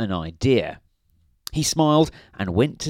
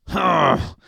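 grunting; 8 semitones higher, 5 dB louder than usual. A narrator's reading. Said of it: "grunted Mister Grumpy".

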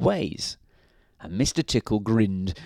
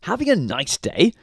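grinning; 8 semitones higher, 4 dB louder than usual. A narrator's reading. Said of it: "grinned Mister Happy".

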